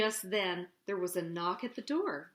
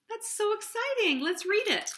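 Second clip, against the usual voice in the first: bright, eager and high; excited; 10 semitones higher than usual. Real, not acted. Storyteller excited before reading.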